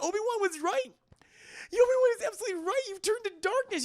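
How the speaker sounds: hammed-up dramatic accusation; high, fast mock scolding; accusing Padme.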